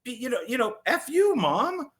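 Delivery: put-on high, indignant old man talking back; mock defiant grandpa. Grandpa Joe telling off Charlie's mom, high and indignant. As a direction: pitch up and defend the kid like a cranky grandpa. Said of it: "Grandpa Joe was like".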